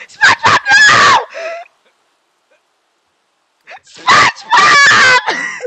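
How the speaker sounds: very high wailing shout of a name; anguished scream. Real, not acted. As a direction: scream his name in despair. SpongeBob dies in game.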